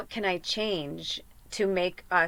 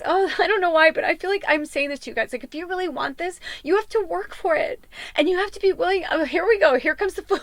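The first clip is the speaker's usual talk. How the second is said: much higher, teary, breaking voice; telling viewers to work for it, crying; 9 semitones higher, 4 dB louder than usual. Real, not acted. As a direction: push the words out through tears. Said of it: "I knew I was gonna cry".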